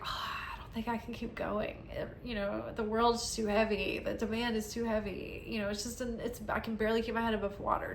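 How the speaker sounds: soft, half-whispered defeat; weary despair. Acted. Voicing someone who can barely keep going, quietly despairing. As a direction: sigh it out quietly, drained.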